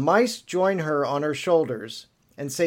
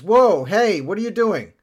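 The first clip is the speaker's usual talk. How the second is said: startled; 6 semitones higher, 6 dB louder than usual. Acted. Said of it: "And Shiro says".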